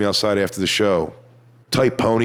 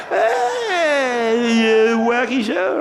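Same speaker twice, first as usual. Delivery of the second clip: very high, drawn-out goofy squeal; jerk-off greeting; 12 semitones higher, much slower than usual. Retold or imitated. Comic mocking how he sounded to a fan, squealed goofy greeting. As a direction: stretch it out in a silly piercing falsetto. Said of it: "The fucking jerk off I sound like".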